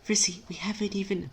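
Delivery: high, hushed flustered protest; flustered timid friend. Acted.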